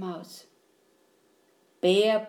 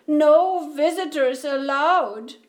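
higher, louder, mildly tearful; sniffling insistence; 4 semitones higher, 5 dB louder than usual. A narrator's reading. Bear sets his rule for the mouse, sniffling. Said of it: "he sniffled".

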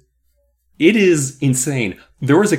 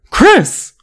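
high, loud scolding cry; shocked cry; 7 dB louder than usual. A narrator's reading. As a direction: call out the name in horror. Horrified teacher scolds the girl.

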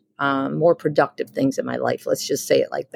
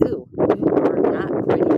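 airy, high, breathy-free mock sneer without voice; high whispered put-down to herself; harsh inner voice; 8 semitones higher than usual. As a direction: whisper it like a cruel thought at the mirror. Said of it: "me waking up in the day going".

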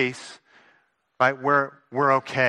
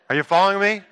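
usual speaking voice

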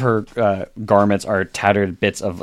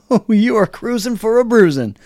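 high, put-on bird-creature voice; frightened mimic creature, pitched way up; scared Kenku; 13 semitones higher, 4 dB louder than usual. Scared Kenku scrambling down from the vehicle, high creature voice. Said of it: "scared, goes".

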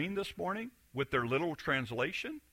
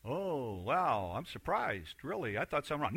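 mock soft concern; 4 semitones lower, 8 dB quieter than usual. Partner asking what's wrong.

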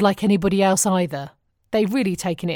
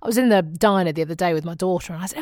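usual speaking voice

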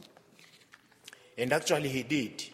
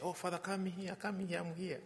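higher, soft imitation of a host calling; softly pitched-up quoted welcome; imitated welcoming call; 6 semitones higher, 10 dB quieter than usual. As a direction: lightly mimic someone beckoning a guest in. Voices the welcoming priest calling him in.

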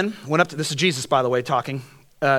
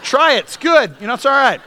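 tempting dealer; 9 semitones higher, 6 dB louder than usual. Retold or imitated. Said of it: "they're like".

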